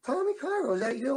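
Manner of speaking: high, startled call of a name; surprised greeting. Retold or imitated. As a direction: recognise an old friend out of nowhere. Old friend spots him, surprised.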